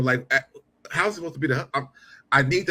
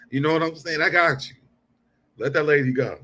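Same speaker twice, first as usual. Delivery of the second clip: ordinary delivery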